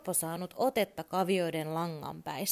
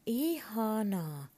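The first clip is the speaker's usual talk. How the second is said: slow, higher little horse voice, shy; a timid toy horse saying its name; a shy pony drawing out its own name, slow and high; 6 semitones higher, much slower than usual. Acted. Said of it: "sanoi hevonen ujosti".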